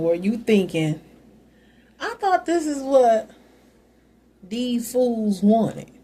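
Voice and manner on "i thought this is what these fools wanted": mock inner voice. Mocking ex-partner's imagined thought.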